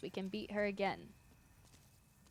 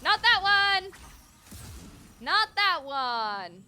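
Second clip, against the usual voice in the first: frustrated shout; 5 semitones higher, 7 dB louder than usual. Real, not acted. Frustrated at wrong shot.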